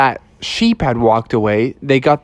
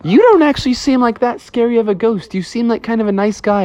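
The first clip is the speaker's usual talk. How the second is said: higher-pitched bear voice chatting to the ghost; friendly bear; 9 semitones higher than usual. Acted. Bear telling the ghost he seems nice, high friendly voice.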